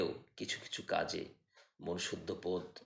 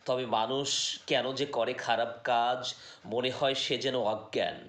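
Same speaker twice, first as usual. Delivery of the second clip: raised, projected Bengali poetry recitation; poem recitation; 4 semitones higher, 7 dB louder than usual. Acted. Reciting a poem that questions humankind, declamatory poetry voice. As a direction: recite in measured phrases, higher and louder than chat.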